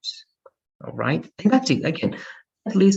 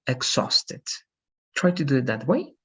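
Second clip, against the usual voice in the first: ordinary talk, nothing marked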